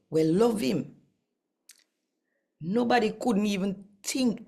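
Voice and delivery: earnest reading of a Bible passage in Jamaican patois; scripture reading. Acted. Reading Corinthians aloud to channel viewers, earnest scripture reading. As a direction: read the scripture with a preacher's conviction.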